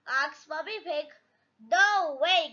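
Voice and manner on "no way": plain reading voice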